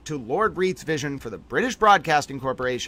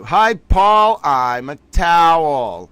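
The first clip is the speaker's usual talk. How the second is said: loud greeting; 3 semitones higher, 10 dB louder, much slower than usual. Real, not acted.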